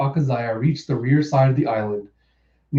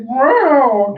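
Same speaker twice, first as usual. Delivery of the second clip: high, loud falling yowl; mock scream; 11 semitones higher, 5 dB louder than usual. Cat Viper screams before landing on his head, mock high yowl. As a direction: let out a playful high scream.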